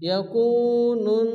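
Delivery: "ইয়াকুনুন" slowly, the নুন nasalised and held; slow nasal "ইয়াকুনুন"; ghunna demo. Shown as a demonstration. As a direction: hum the n of "ইয়াকুনুন" for one alif. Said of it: "এক আলিফ গুন্না করতে হবে".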